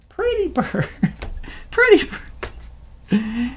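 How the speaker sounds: squeaky high parrot imitation; slow squawky parrot pretty bird. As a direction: talk like a parrot asking for a cracker. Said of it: "can you say pretty bird".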